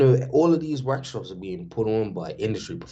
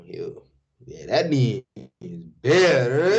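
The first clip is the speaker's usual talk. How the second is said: party voice; 5 semitones higher, 4 dB louder than usual. Shown as a demonstration. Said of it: "when I'm inside of a party".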